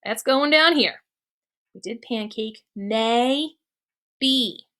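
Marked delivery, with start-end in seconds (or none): 2.8-4.6 May and be split into separate syllables. Shown as a demonstration. Teacher splits maybe into syllables for class. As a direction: pause between May and be. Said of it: "I would say".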